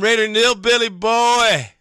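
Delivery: loud, high hail to a buddy; calling out. Real, not acted. Host hailing a fan he missed at the tailgate, loud call-out.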